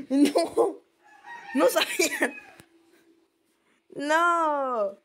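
high-pitched startled wail; real fright. Real, not acted. Gamer just scared by the game, high frightened protest. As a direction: wail it out, still shaken. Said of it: "Ay, me asusté".

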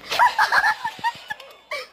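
squealing high laugh; high giggle. Real, not acted. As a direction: burst out giggling. Pushed by other players.

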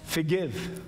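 ordinary delivery

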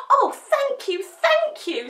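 high, loud, gushing thanks; thrilled little pup voice; delighted puppy. A narrator's reading. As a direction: thank them breathlessly, overjoyed.

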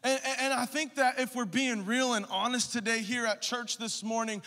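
loud, high preaching at full force; fiery preaching. Acted. Pastor urging the congregation, loud fiery preaching. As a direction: preach it hard to the church.

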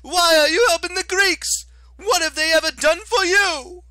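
whiny high-pitched fury, dragged out; Octavian, shrill and outraged. Acted. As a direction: screech like a wounded petty tyrant. Octavian character voice.

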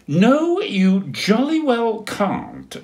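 high, loud indignant refusal, slow on jolly well; huffy penguin shutting them out; cross penguin. Acted. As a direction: stamp your little foot. Dudley refuses sisters.

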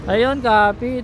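normal talking voice

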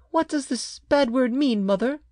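put-on high little boy's question; half-frightened child. A narrator's reading. Child asks his angry mother, half frightened. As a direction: ask high, as a slightly scared child. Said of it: "half frightened by her anger".

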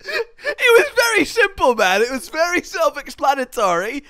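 very high-pitched insistence; exasperated explaining. Real, not acted. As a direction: spell it out in a squeaky, exasperated voice.

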